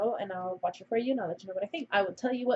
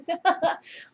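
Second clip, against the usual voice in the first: giggle; 9 semitones higher, 4 dB louder than usual. Real, not acted. Laughing about her cousin.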